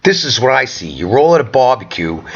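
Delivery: normal talking voice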